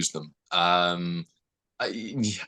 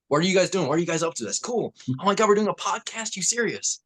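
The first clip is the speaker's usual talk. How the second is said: higher-pitched, excitable American guy; American accent; 10 semitones higher than usual. Shown as a demonstration. Actor shows hosts his American on a podcast, excited American accent. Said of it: "American accent, cool. Dan in the American".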